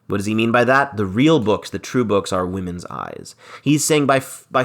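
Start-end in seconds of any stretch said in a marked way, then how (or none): none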